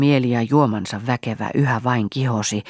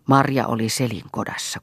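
usual speaking voice

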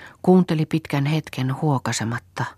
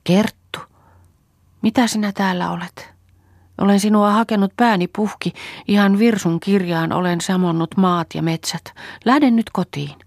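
higher, anxious plea; a touch of fear, pitched up; timid, worried appeal in a raised pitch; trembling voice; 7 semitones higher, 3 dB louder than usual. A narrator's reading. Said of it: "Viimein virkkoi vapisevalla äänellä".

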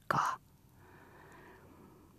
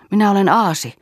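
higher, louder self-reproaching cry; exclaiming; 7 semitones higher, 6 dB louder than usual. A narrator's reading. Bengt cries out at himself, loud and high. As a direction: burst out against yourself. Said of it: "hän huudahti".

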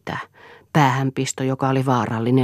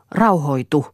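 louder, pleading call for calm; urgent plea; 3 semitones higher, 5 dB louder, slower than usual. A narrator's reading. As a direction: plead under attack, voice raised. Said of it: "pyysi Lea". (hätäinen, korotettu pyyntö)

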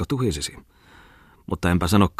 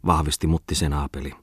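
ordinary delivery, nothing marked

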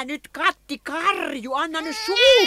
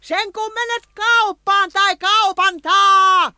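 angry; 7 semitones higher, 5 dB louder than usual. Acted. Quarrelling with the cat, sending him off to the shop angrily. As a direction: fling it after them in a temper.

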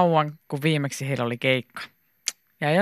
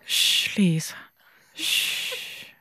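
whispered shushing; quiet shh to silence someone; hushing; 3 semitones higher, 4 dB quieter, much slower than usual. A narrator's reading. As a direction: hush her with soft breath. Krista hushes Liisa.

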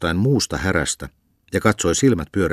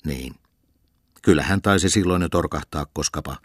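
plain reading voice

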